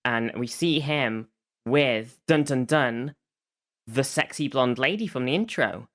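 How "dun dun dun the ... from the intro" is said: ordinary delivery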